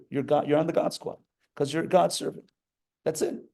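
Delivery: ordinary delivery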